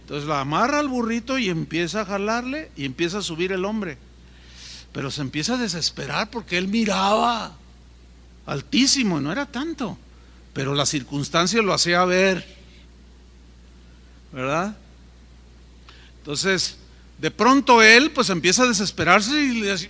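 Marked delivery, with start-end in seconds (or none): none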